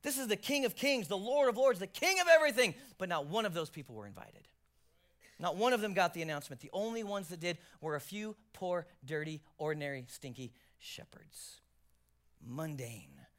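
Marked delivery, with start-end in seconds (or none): 0.0-2.7 building, ringing proclamation of titles; rising proclamation. Acted. Pastor proclaiming the King to the congregation, building. 10.1-13.3 slow stage whisper, much lower and softer. Acted. Pastor confiding to the congregation, slow whisper.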